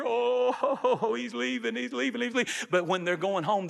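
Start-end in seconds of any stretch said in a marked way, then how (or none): 0.0-2.5 higher, laughing voice for the crowd seeing him go; a light laughing send-off; mock crowd voice. Crowd on earth at the deathbed.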